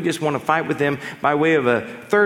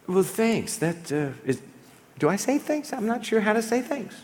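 unsure thanks; 6 semitones higher, 4 dB quieter than usual. Retold or imitated. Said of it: "I get this one all the time".

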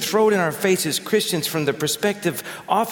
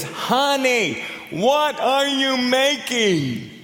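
much higher, very slow drawn-out call; calling out again; 9 semitones higher, much slower than usual. Retold or imitated. Husband repeats to his wife from five feet, slow high call. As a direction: stretch every word, high and insistent. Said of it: "He says".

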